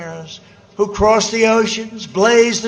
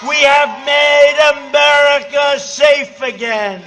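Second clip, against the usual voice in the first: booming rally crescendo, rising chants; rally speech; 4 semitones higher, 3 dB louder than usual. Real, not acted. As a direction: shout each line to a roaring crowd.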